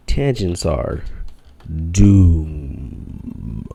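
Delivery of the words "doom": drawn-out, booming doom voice. Shown as a demonstration. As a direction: intone it like a doomsayer. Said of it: "That's my, that's my doom voice".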